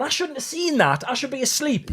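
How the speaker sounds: startled fox voice. Retold or imitated. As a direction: squeak it out like a caught fox. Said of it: "I remember going".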